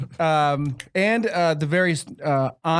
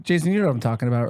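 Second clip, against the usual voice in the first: usual speaking voice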